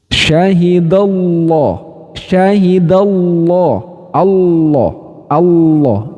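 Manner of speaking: Syahidallah and Allah with a full, pressed, dark lam, slow; slow heavy tafkhim Allah; pressed thick. Shown as a demonstration. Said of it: "Jadi ditekan".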